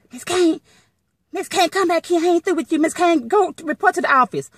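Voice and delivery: high-pitched mimicked supervisor call; supervisor calling after her. Supervisor calls after her outside the locker room, mimicked summons.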